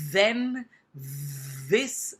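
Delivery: "then, this" with a strong buzzing th; voiced th, loud. Shown as a demonstration. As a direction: make the th in "then" and "this" vibrate. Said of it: "then I'm doing the".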